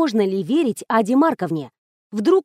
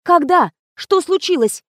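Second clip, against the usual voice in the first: high, urgent, alarmed asking; shocked questions; 7 semitones higher, 3 dB louder than usual. A narrator's reading. Narrator demands details of the death, alarmed and high.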